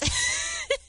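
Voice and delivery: high-pitched burst of laughter. Real, not acted. After telling how her night ended, high laugh. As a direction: crack up at your own story.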